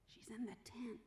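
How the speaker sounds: soft secret whisper. Retold or imitated. Abraham covers for Sarah quietly.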